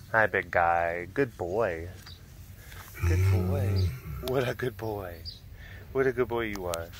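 low, soft praise; softly murmured fondness; tender pet talk. Real, not acted. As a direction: coo gently to a big animal. Cooing praise to a cat.

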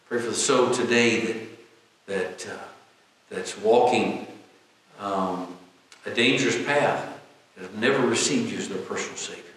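low, earnest public prayer in measured phrases. Real, not acted. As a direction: lead the congregation in prayer, pitched low.

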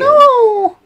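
very high, loud cry of grief; hammed-up despairing scream; mock wail. Player mourning the game fox, mock wail.

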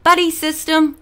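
teacher calling out the rule; called-out rule. A narrator's reading. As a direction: bark it like a drill. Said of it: "Then Mister Russo added".

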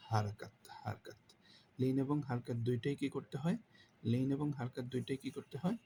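usual speaking voice

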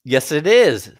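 sharp loud contradiction; barked. A narrator's reading. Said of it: "barked you".

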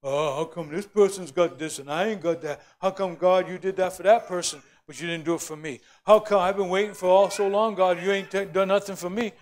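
a hint of a whiny complainer; mildly mock the moaning Israelites; complaining whine. Preacher mimicking complaining Israelites, mild whine. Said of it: "moan and groan and complain".

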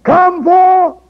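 high, slow, loud holler. Retold or imitated. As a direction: call the dead man out of the tomb. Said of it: "he hollered and said".

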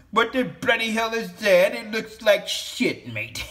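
high, hammed-up English accent; mock English accent. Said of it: "I'm from across the bloody pond".

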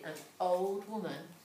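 plain reading voice

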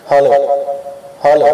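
hello with heavy echo; echo effect. Shown as a demonstration. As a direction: call hello into an echoing mic. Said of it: "ये इको आ रही है इसके अंदर".

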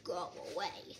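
low, soft grumble; drowsy, low dismissal; sleepy Toad. A narrator's reading. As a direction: send them off quietly from under the covers. Sleepy Toad answers from bed.

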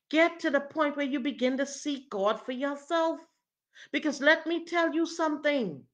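loud, high, driving exhortation; full-force preaching. Real, not acted. Preacher urging pastors to seek God, full-force preaching. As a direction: preach it hard, pressing every command.

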